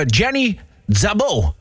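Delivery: slow, drawn-out artist name; showy name reveal. Real, not acted. Announcing the singer's name for effect.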